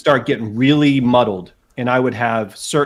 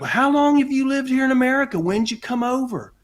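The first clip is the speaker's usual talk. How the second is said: mimicking wide-eyed questioning, much higher; gullible American fan; 13 semitones higher than usual. Fooled Americans ask when he moved here, gullible mimicry. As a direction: put on an impressed listener, pitched way up.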